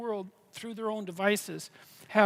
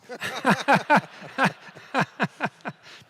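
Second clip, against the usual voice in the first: a run of chuckles; laugh; 4 semitones higher, 6 dB louder than usual. Real, not acted. Guest laughing after the power boat answer, chuckling. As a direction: laugh at your own joke.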